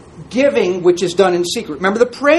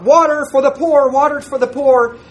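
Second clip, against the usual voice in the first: raised, higher-pitched street-seller cry; acted public call, pitched up; 6 semitones higher than usual. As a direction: call it out like a water seller hawking to a crowd. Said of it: "he would call out".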